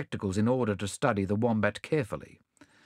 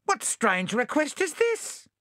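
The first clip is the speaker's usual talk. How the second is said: high, innocent possum voice; sly possum voice. Acted.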